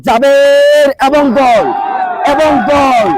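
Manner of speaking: drawn-out commentator's goal shout; excited goal call. Real, not acted. Commentator announcing a penalty goal to listeners, excited shout. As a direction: stretch the name, then cry the goal.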